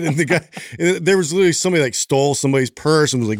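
ordinary delivery

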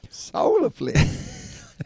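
high, stretched-out mocking repeat of "Solarplex"; mocking echo. Teasing his co-host's word, high and slow. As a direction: drag "Solarplex" way up and slow to tease.

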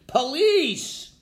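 acted shout for the police; calling out. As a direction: call straight to the one who can help. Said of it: "to call out specifically".

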